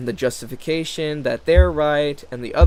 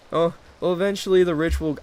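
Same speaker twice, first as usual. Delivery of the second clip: normal talking voice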